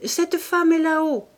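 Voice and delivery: crié beaucoup plus haut et plus fort; crié de loin. Acted. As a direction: appeler du fond de la loge. La concierge appelle de la loge. (la concierge crie du fond de la loge, haut et fort)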